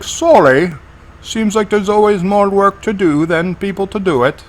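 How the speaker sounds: high-pitched British official, imitated; thick British accent. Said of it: "with a thick British accent".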